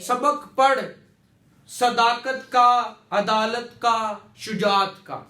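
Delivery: "सदाकत का", "अदालत का", "शुजात का" as separate items, each "का" held; firm "सबक पढ़", a pause, then list "सदाकत का, अदालत का, शुजात का"; pauses at commas. Shown as a demonstration. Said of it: "कोई पॉज लेने के लिए".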